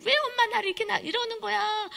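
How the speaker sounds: high, loud acted tantrum; screaming child. Child demanding answers from mom, shrill screaming protest. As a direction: wail in protest like an upset kid. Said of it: "악을 쓰고 이러는 것이".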